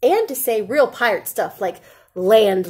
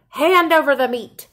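pirate demand; 5 semitones higher, 5 dB louder than usual. A narrator's reading.

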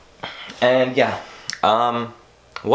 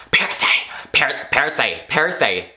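whispering a name urgently to wake someone; hushed, insistent calling in a whisper; 5 semitones higher than usual. Retold or imitated. Mom waking him at night.

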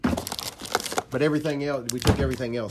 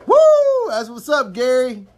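hyped shout; 10 semitones higher, 12 dB louder than usual. Real, not acted. Hyped greeting to Gary.

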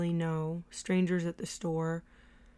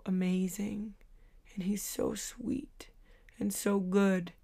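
hushed awe; 5 dB quieter than usual. Real, not acted. Reader moved to tears, whispering her devotion to God. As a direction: breathe it out in awed devotion. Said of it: "I actually started to cry".

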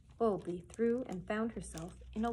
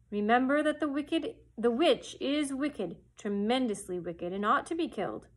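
loud, commanding wizard head; booming wizard Oz; 4 dB louder than usual. Acted. The great head sets Dorothy his price, loud and commanding. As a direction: lay down the terms loudly, like a stern wizard.

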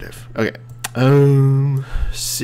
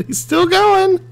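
very high, loud squeal; giddy amazement; 5 dB louder than usual. Real, not acted. Player sees his machine survive, giddy amazement. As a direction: squeal it in delighted disbelief.

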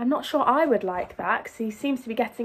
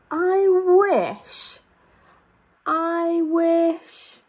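eager wishing; 6 semitones higher, 3 dB louder, much slower than usual. Acted. Building wish in story.

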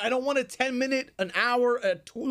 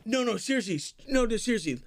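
ordinary talk

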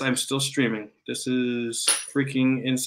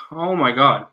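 ordinary talk

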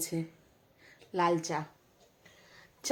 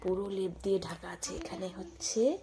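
whispering; 6 dB quieter than usual. Real, not acted. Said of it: "ওই কারণে এত আস্তে আস্তে কথা বলছি".

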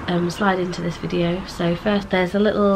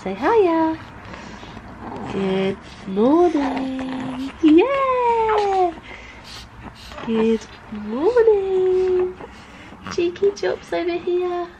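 high, drawn-out cooing greeting; 8 semitones higher, much slower than usual. Real, not acted. Mother greeting babies good morning, singsong and high.

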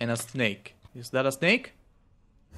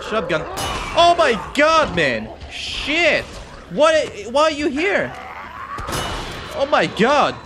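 much higher, loud panicked yells; frantic screaming; 9 semitones higher, 7 dB louder than usual. Real, not acted. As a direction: shriek in real terror. Frantic fight, dying in game.

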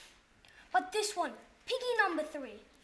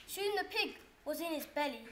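ordinary delivery, nothing marked

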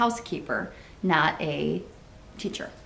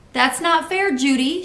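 loud, high protest; child's shout; 6 semitones higher, 5 dB louder than usual. A narrator's reading. Andy accusing Judy over apples, loud high shout. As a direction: shout a complaint at a playmate. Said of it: "shouted Andy".

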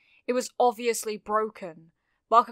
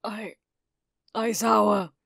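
rough, halting croak building louder; 5 dB louder than usual. A narrator's reading. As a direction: force the name out in a rough voice. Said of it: "came a rough voice".

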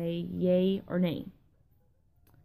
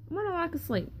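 lack of sleep said higher in pitch; higher repeat; 8 semitones higher, 4 dB quieter, faster than usual. Real, not acted. Telling viewers again she's just sleepless, in a higher voice. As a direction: say it again up high, playful. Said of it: "I'm not drunk, guys".

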